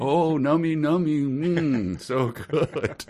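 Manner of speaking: high, sing-song fake yumminess; badly faked enjoyment; toddler-level pretending. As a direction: make exaggerated play-tasting noises. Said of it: "pretending to drink tea".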